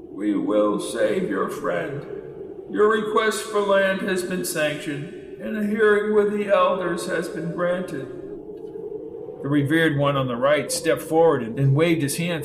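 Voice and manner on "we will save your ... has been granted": ancient elder. A narrator's reading. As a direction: intone like an ancient sage. Elder speaks.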